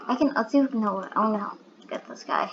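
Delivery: usual speaking voice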